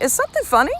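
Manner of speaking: high, loud mock confrontation; sarcastic challenge. Mother confronting eye-rolling couple at luggage carousel, sarcastic challenge. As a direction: throw it back at them, challenging. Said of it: "and I was like".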